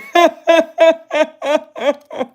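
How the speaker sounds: squealing cackle; high laugh. Real, not acted. Laughing at the green giant joke, high cackle.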